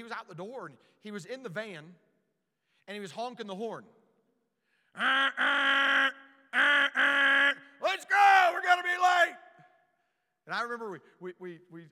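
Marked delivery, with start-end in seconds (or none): 5.0-9.4 high, loud, hurried dad shouting; impatient father calling from the van; impatient dad yelling. Retold or imitated. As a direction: bellow like a father late for church.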